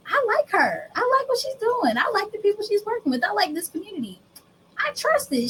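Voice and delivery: high, swooning fan voice; breathless, starry-eyed client; gushing prospect. Retold or imitated. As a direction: gush like a won-over customer. Prospect voiced praising the brand.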